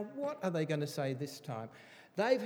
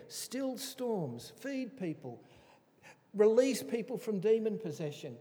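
ordinary talk

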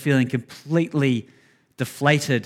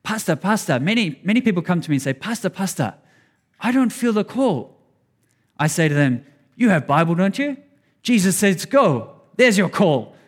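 put-on higher, louder Thai-accented pastor impersonation; 5 semitones higher, 3 dB louder than usual. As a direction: imitate a Thai pastor's accented English, pitched up. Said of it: "I'll say it with the accent".

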